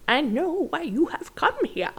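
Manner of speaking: high, gentle fairy godmother voice; kindly old godmother; old lady voice. Acted. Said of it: "your old lady voice is my favorite thing ever".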